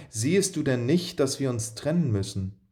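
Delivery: plain reading voice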